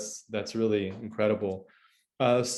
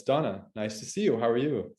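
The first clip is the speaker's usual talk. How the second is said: ordinary delivery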